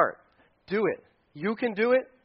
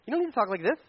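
higher-pitched mock pious voice; weird affected prayer talk; 5 semitones higher, faster than usual. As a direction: put on an odd, high voice to mock stilted praying. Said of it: "That sounds really weird anyway".

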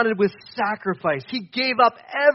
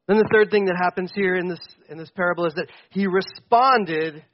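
normal talking voice

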